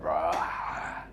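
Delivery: low lion roar; Mufasa. Acted. Lion waking up the cub, roaring. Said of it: "gives out this big, like, Mufasa roar".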